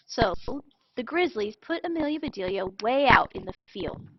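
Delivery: usual speaking voice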